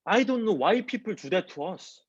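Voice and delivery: ordinary delivery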